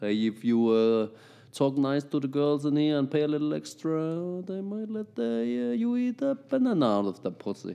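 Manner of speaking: sælg det som en skaldet dørmand, kun antydet; dørmandens salgstale. Retold or imitated. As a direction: let spillet dørmand, meget højere og langsomt i midten. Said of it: "han laver bare den vildeste salgstale". (skaldet dørmand der sælger til turister, halvhjertet efterlignet)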